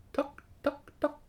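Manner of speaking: high, separate little tocks; pecking sound. A narrator's reading. Woodpecker pecks holes in the egg, tapping. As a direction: tap out each peck.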